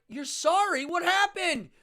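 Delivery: shouted disbelief; incredulous. Retold or imitated. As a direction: confront the performer. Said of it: "And I'm like".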